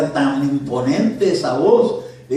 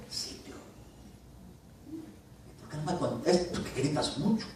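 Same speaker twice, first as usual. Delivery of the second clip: much softer, gentle reply; soft voice of God; 3 semitones higher, 12 dB quieter than usual. Retold or imitated. Preacher voicing God answering softly, very quiet. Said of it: "como la del Espíritu Santo, que habla así suavemente".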